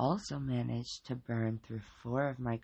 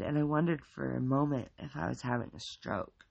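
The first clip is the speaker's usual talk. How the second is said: plain reading voice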